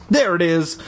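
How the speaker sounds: high happy cry; high delighted exclamation. Acted. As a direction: cry it out with joy. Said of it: "my brother cried happily".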